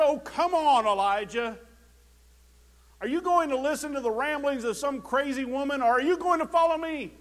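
loud, hammed-up scolding; a hint of an exasperated God; mock scolding God. Preacher voicing God scolding Elijah, loud mock scolding.

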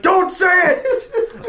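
put-on high, slow, wailing old priest warning; a hammed-up old Irish priest begging. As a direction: plead like a frantic old priest. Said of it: "He's like".

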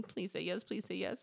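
soft, low inner plea; pleading thought. Retold or imitated. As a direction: beg under your breath, as a private thought. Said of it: "I'm thinking".

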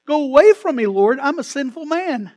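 loud, high cry of a sinful man; anguished confession. Acted. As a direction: shout the plea, breaking into confession. Peter's plea acted again.

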